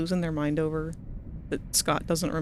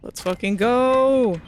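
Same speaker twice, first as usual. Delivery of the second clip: hyped cheer; 3 semitones higher, 5 dB louder, slower than usual. Real, not acted.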